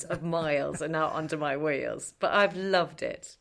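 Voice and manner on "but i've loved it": ordinary talk, nothing marked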